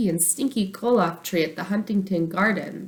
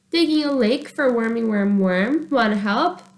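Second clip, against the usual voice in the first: put-on higher, louder little-girl voice; 5 semitones higher, 3 dB louder than usual. Little sister inviting brother to dig, girlish voice. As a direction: play the cheerful little sister.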